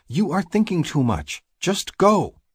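high, insistent sister voice; high-pitched push to get going; exasperated sister. A narrator's reading. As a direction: order your brother off in a high voice. Sister orders hesitant brother off.

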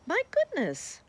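high, delighted spider mother exclamation; exclaiming. A narrator's reading. Missus Spider to Sammy, high delighted exclamation. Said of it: "she exclaimed".

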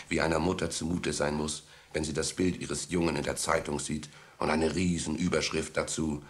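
ordinary talk, nothing marked

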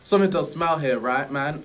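laid-back street-wise genie; cool guru voice. Acted. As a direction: play it smooth and relaxed, a genie with swagger. Acted guru lines.

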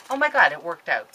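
신나서 터져 나오는 안도; delighted relief. Real, not acted. Thrilled the packing worked out.

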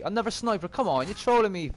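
loud, high exasperated protest; real gamer's exasperation, pitched high. Real, not acted. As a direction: complain loudly, voice jumping up in disbelief. Exasperated at getting another sniper.

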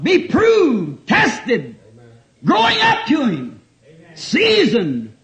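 high, punched preaching bursts; exhorting cries. Real, not acted. Preacher urging believers to mature, crying out. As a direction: call out each demand to the congregation.

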